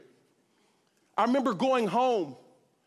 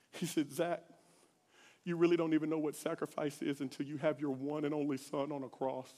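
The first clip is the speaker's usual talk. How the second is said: gentle voice of God; 7 semitones lower, 7 dB quieter than usual. Retold or imitated. God answering the crying teacher, soft and tender. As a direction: speak it quietly like a loving father.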